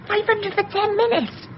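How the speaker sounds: high disbelieving echo; can't believe the sum; incredulous. Acted. Woman doubting John's five hundred quid offer, incredulous.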